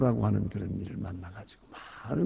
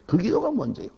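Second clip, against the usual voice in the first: ordinary talk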